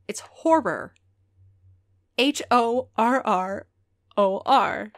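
"horror" pronounced deliberately, then slow spelling; careful enunciation. Shown as a demonstration. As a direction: stress that it's "horror", letter by letter. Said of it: "I can't stress enough".

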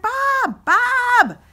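calling out. Retold or imitated. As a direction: yell across the crowd to get his attention. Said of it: "I'm like".

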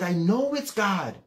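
high, stretched insistence; insistent protest. Retold or imitated. Teenage son answering his doubting mom, insistent.

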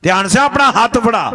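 loud, high command; commanding queen. Acted. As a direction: order him to give his hand. (ਉੱਚੀ ਹੁਕਮ ਭਰੀ ਆਵਾਜ਼)

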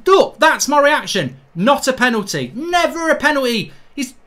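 ordinary delivery

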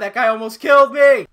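loud, rising yelp of alarm; startled shout. Real, not acted. Gamer to viewers after nearly getting killed, startled shout. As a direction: shout it like you barely escaped.

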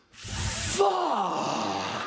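huge high drawn-out shout; stunned outburst. Retold or imitated. Sick boy suddenly seeing his hero, stunned shout. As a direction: imitate an awestruck fan, yelling it long and loud.

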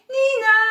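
loud, high nee-naw; wailing cop-car noise; police siren. A narrator's reading. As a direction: imitate a siren by voice. Narrator imitates police siren.

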